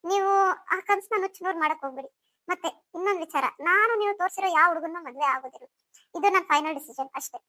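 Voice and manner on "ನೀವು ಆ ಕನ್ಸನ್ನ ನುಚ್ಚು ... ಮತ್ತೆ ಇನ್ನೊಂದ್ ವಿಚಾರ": ordinary talk, nothing marked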